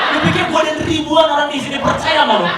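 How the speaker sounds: outraged shout at the announcer; mock indignant shout. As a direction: yell back at the train speaker. Yelling at train announcement.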